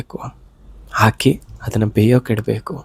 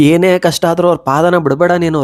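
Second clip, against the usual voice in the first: emphatic plea; 7 semitones higher, 4 dB louder than usual. Real, not acted. Repeating a plea, raised.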